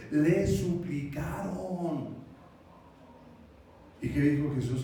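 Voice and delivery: ordinary delivery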